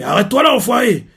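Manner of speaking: angry warrior shouting at a rival who walks away; angry call. Retold or imitated. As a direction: stop him in his tracks. Jiaga yelling at departing rival.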